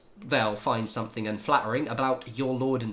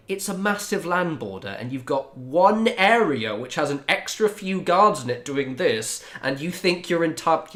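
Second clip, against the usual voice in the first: raised-voice incredulous rebuttal; exasperated retort; 6 semitones higher, 6 dB louder than usual. Retold or imitated. Answering a colleague's border boast, exasperated and incredulous. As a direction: argue back, higher and more forceful.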